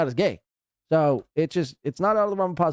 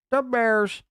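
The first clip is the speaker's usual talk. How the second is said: higher-pitched mocking Chicago accent; 9 semitones higher than usual. Reactor mocking the host's best shot, imitated Chicago accent. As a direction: parody a feeble attempt at a Chicago accent. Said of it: "shot at a Chicago accent".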